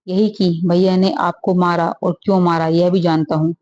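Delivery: ordinary delivery, nothing marked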